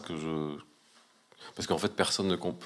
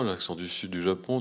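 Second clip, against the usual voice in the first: ordinary delivery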